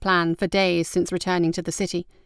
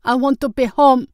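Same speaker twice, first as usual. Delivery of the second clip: high, loud wail for home; crying out; 6 semitones higher, 5 dB louder than usual. A narrator's reading. Frightened brother fighting in the water, crying out loudly. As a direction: wail it out, pitched right up. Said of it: "as Su Shong cried".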